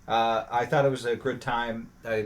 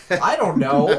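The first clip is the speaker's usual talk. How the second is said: laughing through it, higher and louder; helpless laughing admission; 4 semitones higher, 5 dB louder than usual. Real, not acted. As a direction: blurt it out mid-laugh, giving up. Laughing at an obscure trivia question.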